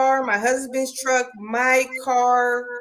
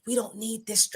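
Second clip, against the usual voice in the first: hushed so the man upstairs won't hear; hushed, near whisper; 6 dB quieter than usual. Retold or imitated. Wife complaining about husband's truck while he's upstairs, hushed. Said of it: "I'm whispering 'cause he's upstairs".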